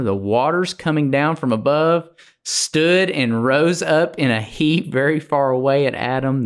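preacher reading out a Bible passage; scripture reading. Acted. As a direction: read the verse aloud with rising momentum. Reads scripture aloud.

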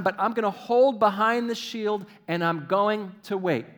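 loud, high, measured proclamation of faith; impassioned preaching. Real, not acted. Preacher declaring David's psalm to the congregation, impassioned. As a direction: declare it with full conviction.

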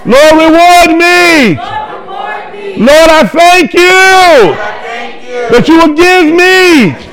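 high, full-force preacher shout; going loud. Real, not acted. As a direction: lead the congregation in shouted prayer, phrase by phrase. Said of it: "we going loud".

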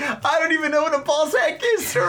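put-on very high, whiny little-boy voice; mock terrified child apologizing to a grown-up; frightened kid pleading. Child pleading with yelling clerk.

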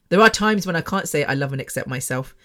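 ordinary talk

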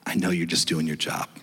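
humbled caller apologizing to the rep; gentle apology. Retold or imitated. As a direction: soften right down and apologize. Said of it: "I became more gentle".

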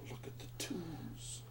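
slow, very soft whisper; hushed whisper. Real, not acted. Player seeing the dice come up twos, hushed whisper. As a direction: whisper it slowly in awe.

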